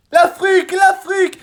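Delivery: very high, very loud excited cries; shouting. A narrator's reading. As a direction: wake everyone with the news. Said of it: "les cris de Zigomar".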